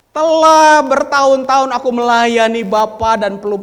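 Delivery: loud, high-pitched take; loud son's quote. Acted. Elder son loudly complaining to his father. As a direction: shout the son's words. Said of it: "Anak sulung ini berkata kepada ayahnya".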